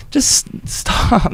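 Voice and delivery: exasperated whisper; whispered stop. Real, not acted. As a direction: breathe it out, fed up. Exasperated aside.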